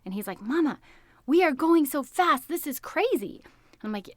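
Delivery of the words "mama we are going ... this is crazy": lightly voiced little boy, higher and excited. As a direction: play the thrilled son, a touch higher. Son excited on the paddleboard.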